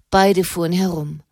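usual speaking voice

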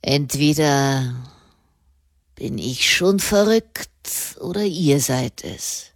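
sneering. A narrator's reading. Prisoner to the nun in his cell, sneering. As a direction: jeer as if one of you is mad. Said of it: "höhnte er".

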